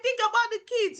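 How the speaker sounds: high, lightly voiced pleading onlooker; a touch of a relative begging them to stay. Relatives urging a quitting spouse to stay, mock pleading. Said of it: "They'll be like".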